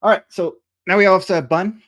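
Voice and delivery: ordinary talk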